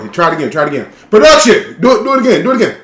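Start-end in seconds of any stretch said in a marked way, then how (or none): 1.1-2.8 loud high call to crew. Real, not acted. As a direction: shout across the room. Calling out to production crew.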